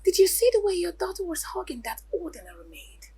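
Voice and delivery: ordinary talk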